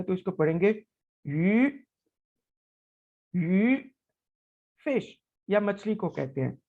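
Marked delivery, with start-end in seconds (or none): 3.3-3.8 teacher modelling यू in rising second tone. Shown as a demonstration. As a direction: let यू climb upward. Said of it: "second tone के साथ".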